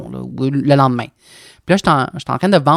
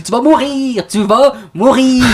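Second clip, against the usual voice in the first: higher, slow mock warning; family catchphrase; 7 semitones higher, much slower than usual. Son mimics his mother's warning as a family joke. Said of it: "Moi, je dis".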